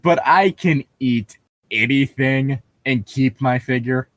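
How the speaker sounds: ordinary delivery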